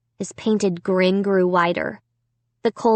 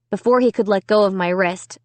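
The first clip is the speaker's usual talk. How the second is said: ordinary delivery, nothing marked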